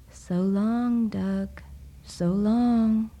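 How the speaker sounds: ordinary delivery, nothing marked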